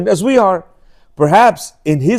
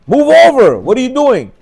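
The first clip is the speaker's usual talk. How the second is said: higher, louder rebuke to the photographer; retold rebuke; 5 semitones higher, 4 dB louder than usual. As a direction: snap at him to move. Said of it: "reproached him".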